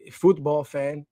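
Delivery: football fan said slowly, as futbol, British-style; stylistic football accent. Shown as a demonstration. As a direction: stretch out football fan in a posh soccer accent. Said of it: "in a little stylistic accent".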